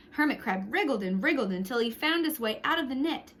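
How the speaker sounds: usual speaking voice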